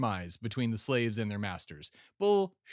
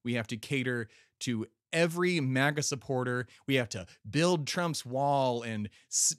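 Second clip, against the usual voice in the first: ordinary talk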